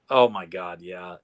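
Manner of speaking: ordinary talk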